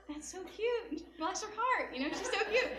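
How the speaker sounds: high, laughing coo. Retold or imitated. Couple cooing over the little girl's sweet comment, high. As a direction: gush over her sweetly.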